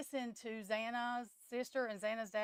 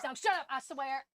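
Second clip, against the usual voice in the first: sharp irritated shout at someone in the room; exasperated real snap; snapping; 4 semitones higher, 5 dB louder than usual. Real, not acted. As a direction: tell them off, louder and higher.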